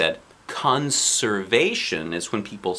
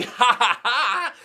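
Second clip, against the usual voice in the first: mock laughter; 12 semitones higher, slower than usual. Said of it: "shouted back".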